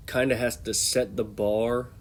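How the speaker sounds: ordinary talk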